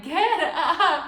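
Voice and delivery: high, loud retold yell; exasperated shout. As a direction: shout a sleeping husband out of bed. Husband fell back asleep, shouts again.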